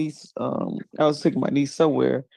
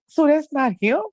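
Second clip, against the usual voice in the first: high, animated imitation of a puzzled girl; innocent niece. Niece asking her aunt about the caller, imitated innocence. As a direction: mimic her guileless confusion. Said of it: "'Cause the way she said".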